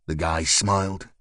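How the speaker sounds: plain reading voice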